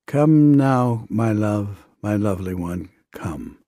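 loving invitation sinking to a hush; tender call. Retold or imitated. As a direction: call out lovingly, letting each phrase fall away. Abba's loving call to dying Yolanda.

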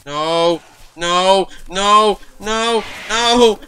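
rising terrified shouts of no; panicked screaming. Real, not acted. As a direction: scream as the monster closes in. Chased in horror game.

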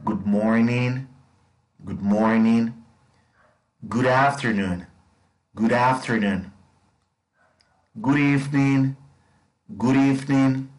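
careful classroom drill; model for repetition. Shown as a demonstration. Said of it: "repitan conmigo".

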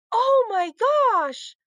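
high, loud, drawn-out squeal of delight; delighted cry. A narrator's reading. Heidi seeing real snow outside, delighted cry. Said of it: "Heidi cried".

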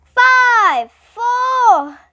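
high, loud countdown; shouted countdown. A narrator's reading. Principal shouting through cotton balls, loud countdown. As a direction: bellow each number. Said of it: "had to shout the numbers".

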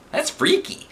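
very high squeak; squeaky teacher voice. A narrator's reading. Teacher squeaking at the lava plan, high squeak.

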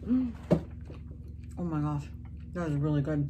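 speaking between bites; talking while chewing. Real, not acted. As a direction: mouth partly full of food. Seen 0: Woman takes a bite at -4, then lips stay pursed and moving while speaking.

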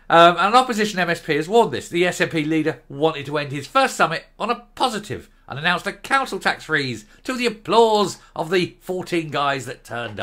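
reading the report aloud like a newsreader; formal news read with a touch of irony. Acted. Reads out the news item.

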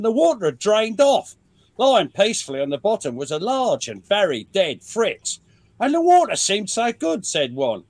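hammed-up Australian storyteller; Australian accent. Reading a soldier's anecdote to show off an Australian accent. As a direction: read it in a put-on Australian accent. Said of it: "the almost perfect Australian accent".

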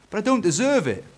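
put-on high-pitched boy protest; humble Eric. Eric feels undeserving before Dad, high boy voice.

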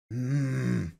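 usual speaking voice

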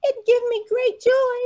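very high, hopeful little bird question; a tiny bird asking a new friend; small bird voice. Acted. Birdie continues.

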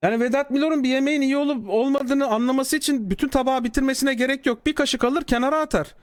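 ordinary talk, nothing marked

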